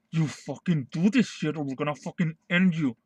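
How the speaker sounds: gruff mock boss threat; threatening publisher. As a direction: lean in like a bullying boss. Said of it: "That was fucking Shonen Jump saying".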